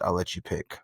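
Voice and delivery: ordinary talk, nothing marked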